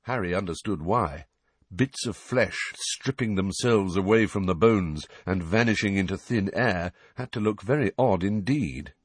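usual speaking voice

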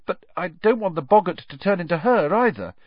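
worried schoolboy protest; nervous. A narrator's reading. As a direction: object anxiously to the teacher. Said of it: "said Neville nervously".

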